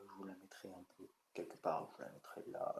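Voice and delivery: breathy unvoiced murmur to himself; whispered aside. Real, not acted. Youtuber mumbling to himself about where to put the image, whispered. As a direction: whisper it under your breath.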